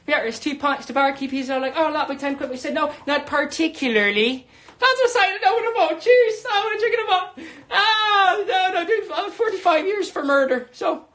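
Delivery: high, loud, gibberish-like Irish babble; drunken Irish accent. Acted. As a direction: babble in a hammed-up tipsy Irish accent, with the odd clear word. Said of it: "it's kinda gibberishy in Ireland".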